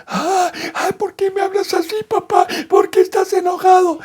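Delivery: put-on high whiny little boy voice; crying child. Son crying at his father after being yelled at. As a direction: whimper like a hurt little kid. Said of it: "el niño está en un show llorando".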